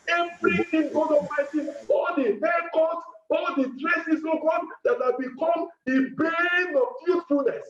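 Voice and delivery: shouting prayer; shouted prayer. Acted. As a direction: cry it out in rising prayer. Crescendo in prayer.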